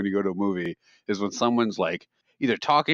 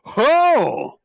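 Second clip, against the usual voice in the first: loud, high drawn-out "ho!"; big wowed exclamation, hammed up; imitated amazement; 14 semitones higher, 6 dB louder than usual. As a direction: mimic an astonished moviegoer crying out. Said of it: "he was genuinely amazed every time".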